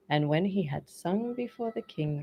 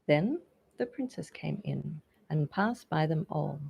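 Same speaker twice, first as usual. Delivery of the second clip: ordinary delivery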